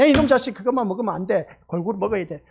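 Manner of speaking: scolding parent. Retold or imitated. Parent scolding a child who eats only what he likes, loud. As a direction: bark at the picky child like a parent. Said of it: "부모가 다 그렇게 말하지 않습니까".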